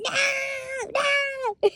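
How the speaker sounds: high, thin mock shout; hammed-up high-pitched protest; mock wail. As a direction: cry it out high without much volume. Acts out Kingsman's panic at ping.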